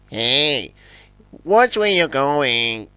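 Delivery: ordinary delivery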